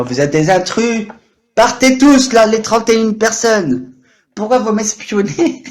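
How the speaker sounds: high, loud, drawn-out indignant yell; mock outrage. Streamer pretends to evict spying viewers, mock outrage. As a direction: holler at intruders in playful outrage.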